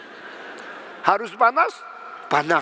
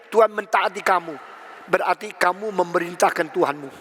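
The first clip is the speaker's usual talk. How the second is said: normal talking voice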